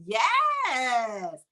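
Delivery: long, drawn-out happy yes; delighted cheer. Real, not acted. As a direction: stretch it into a cheer. Cheers sunny weather report.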